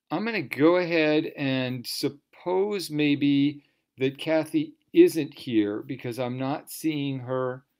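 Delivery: usual speaking voice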